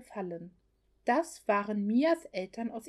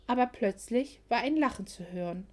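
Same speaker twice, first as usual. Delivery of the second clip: usual speaking voice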